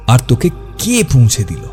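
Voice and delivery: ordinary delivery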